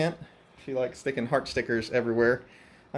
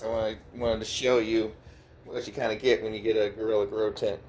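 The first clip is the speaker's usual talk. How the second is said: normal talking voice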